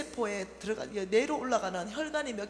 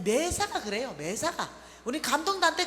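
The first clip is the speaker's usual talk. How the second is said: ordinary delivery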